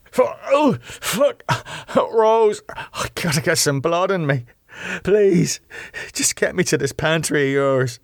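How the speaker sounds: high, strained plea through waves of pain; pained hiss. Acted. Damo begging Rose for blood, hissed in pain. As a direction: beg urgently, gripped by pain. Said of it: "he hissed".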